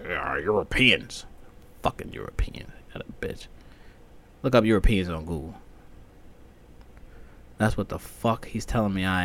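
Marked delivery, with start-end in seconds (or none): 0.1-3.5 imitating brother. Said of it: "like my brother would say".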